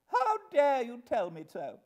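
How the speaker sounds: high, loud outrage; indignant retort. Acted. Mother retorting to little Jane, indignant. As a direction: fire back, scandalised and loud. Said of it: "retorted Missus Pocket".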